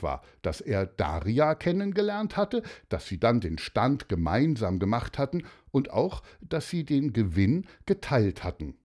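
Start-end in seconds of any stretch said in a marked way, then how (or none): none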